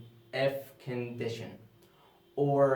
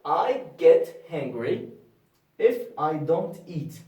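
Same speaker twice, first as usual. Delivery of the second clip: reading the example; 3 semitones higher, 7 dB louder than usual. Shown as a demonstration. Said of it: "Focus here".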